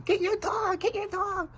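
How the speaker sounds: very high mock squeal of her nagging; petty, squeaky parody of the lady's yell; mocking the neighbor lady. Man petty-mocking the neighbor who yelled about his dog, squeaky parody. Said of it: "I started mocking her".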